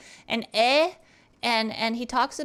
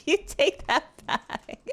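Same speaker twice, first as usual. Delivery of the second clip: laughing; 6 semitones higher than usual. Real, not acted. Laughing protest at teammate.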